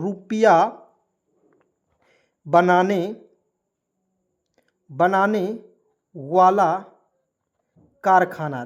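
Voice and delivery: dictating slowly. Shown as a demonstration. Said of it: "मैं लिख रहा हूँ".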